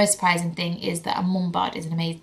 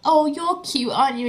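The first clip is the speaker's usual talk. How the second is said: put-on high sing-song baby talk; much higher cooing adult; 9 semitones higher, 4 dB louder than usual. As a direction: coo like a stranger charmed by a baby. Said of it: "where they'll go".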